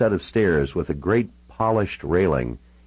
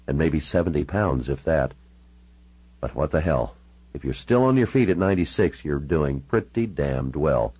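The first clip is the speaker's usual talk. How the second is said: ordinary delivery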